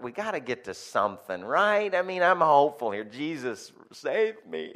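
high, pleading exasperation; mock desperation. Dad hoping for a sensible answer, mock desperate. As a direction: climb in pitch as if begging for help.

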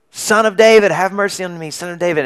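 a beggar calling out to a passing crowd; calling out loudly. Retold or imitated. Blind beggar calls to Jesus, loud plea. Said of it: "Bartimaeus starts yelling out".

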